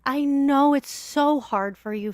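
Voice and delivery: ordinary talk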